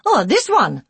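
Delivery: high, loud offhand broken English. A narrator's reading. Care worker at the next cot, offhand broken English. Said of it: "in broken English".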